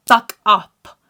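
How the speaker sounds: loud, punched "Fuck up"; forceful dismissal. Retold or imitated. Daughter hammering it home to mum, loud and forceful. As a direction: hit both words of "Fuck up" hard.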